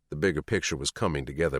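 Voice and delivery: plain reading voice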